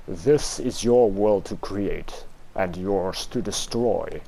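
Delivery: low, slow, solemn narrator. Acted. Unknown deity addresses the player, low, slow and solemn. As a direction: intone it like an unseen god. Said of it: "The narrator voice".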